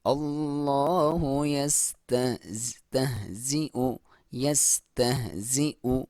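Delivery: slow, drawn-out tajweed chant; slow Quran recitation. Shown as a demonstration. Teacher reciting the verse for students, slow and drawn out. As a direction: recite it slowly, stretching each vowel. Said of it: "सीटी के साथ clear".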